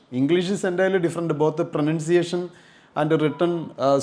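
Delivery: ordinary delivery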